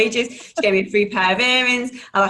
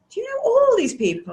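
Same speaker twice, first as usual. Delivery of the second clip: mock amazed friends; 13 semitones higher than usual. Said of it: "they're going".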